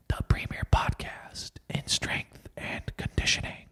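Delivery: slow, hushed whisper. Pretend. Host tells listeners the show's name as if secret, whispering. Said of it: "You wanna know I'm whispering?".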